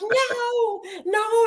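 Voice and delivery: a shrill, desperate mock scream at the agents; retold scream. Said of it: "I just screamed and screamed".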